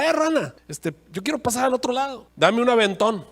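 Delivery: alacrán gritando, mucho más agudo; llama a la rana desde la orilla, agudo; grito del alacrán. Acted. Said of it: "le gritó a la rana". (el alacrán le grita a la rana, agudo y burlón)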